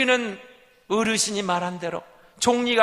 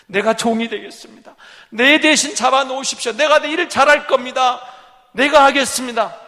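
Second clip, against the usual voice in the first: raised, higher voice begging; loud pleading; 4 semitones higher, 9 dB louder than usual. Acted. Judah offering himself as slave to the governor, loud plea. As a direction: offer yourself, loud and desperate.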